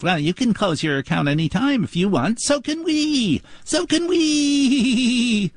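ape the smug bank script, stretching it; mocking bank clerk. Mimicking the bank's script.

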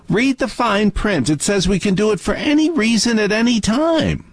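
mock bank voice. Mocking bank's fine print.

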